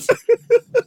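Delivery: squealing laugh; shrill giggle; high shriek of laughter. Real, not acted. Big laugh.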